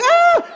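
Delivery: very high imitated child squeal; high mock shriek; child's shriek. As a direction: imitate a little girl's short wail. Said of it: "She's like".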